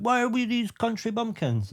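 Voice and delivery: high-pitched, put-out complaint; mock Irish outrage. Irish viewers objecting to hobbit accents, mock upset. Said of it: "the Irish were really upset".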